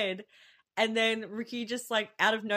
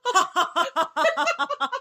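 louder, higher staccato fake cackle; mock maniacal laugh; 11 semitones higher, 7 dB louder than usual. Imitating Ricky's sudden laughing fit, mock laugh. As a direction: burst out in forced, crazed ha-has. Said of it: "like, laughing".

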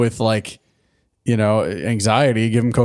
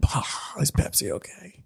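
whispered joke; 6 semitones higher, 12 dB quieter than usual. Retold or imitated. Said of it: "I was like".

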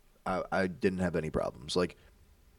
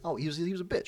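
meek buddy voice; 8 semitones higher than usual. Retold or imitated. To his friend, caught out: high meek greeting. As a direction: play it small and meek, pitched up. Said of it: "that's how he's like".

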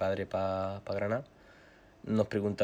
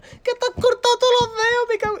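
friend screaming; 11 dB louder than usual. Retold or imitated. Zara keeps yelling.

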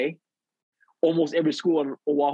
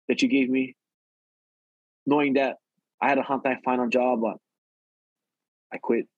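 usual speaking voice

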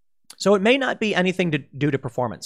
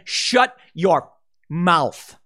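very slow, heavy "Shut your mouth"; snapped retort; much slower than usual. Retold or imitated. People who finally snapped telling critics off, very slow. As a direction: drag each word, fed up.

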